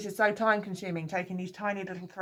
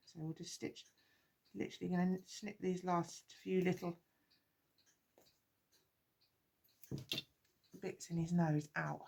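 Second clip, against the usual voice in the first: talking through a face mask; muffled and quiet behind a mask; 9 dB quieter than usual. Real, not acted. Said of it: "Sorry if I sound a bit muffled now".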